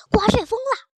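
high, loud warning cry; calling out alarm. Acted. Someone warns the animals of the whirlwind, shouting in alarm. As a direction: shout the news to everyone around.

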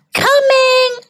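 high, drawn-out answering call; yelling back. A narrator's reading. Girl answers mom's supper call, high mild yell. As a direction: sing it out to someone in another room. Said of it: "I yelled back".

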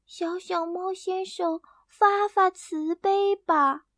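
a tiny mouse pleading for its life; pleading little mouse. Acted. Little mouse begging Hena for mercy, high and slow.

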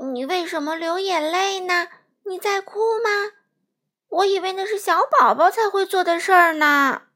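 high, loud wondering fish voice; ask, wide-eyed and small; curious little fish. Acted.

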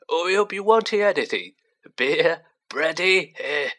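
put-on a toothless old barman's welcome; old landlord Tom. A narrator's reading. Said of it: "said Tom".

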